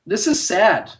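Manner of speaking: ordinary talk, nothing marked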